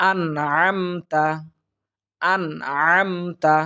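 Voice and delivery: আনআমতা with a clear, unnasal ন, chanted slowly; clear izhar. Shown as a demonstration. Teacher showing izhar for students. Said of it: "ইজহার উচ্চারণ হবে".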